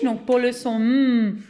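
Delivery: m held long and slow; drawn-out sound. Shown as a demonstration. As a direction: make the letter sing m. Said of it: "qui chante m". (son m prolongé)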